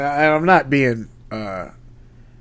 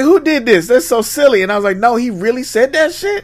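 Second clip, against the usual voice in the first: high, louder retold disbelief; 9 semitones higher, 4 dB louder than usual. Co-host retells his reaction to Trump, stunned and high. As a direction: act stunned and incredulous.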